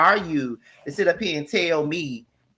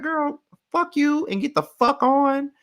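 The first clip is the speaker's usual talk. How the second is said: sassy dismissal; 12 semitones higher than usual. Retold or imitated. Dismissing Kayla in an acted voice.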